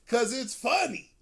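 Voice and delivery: usual speaking voice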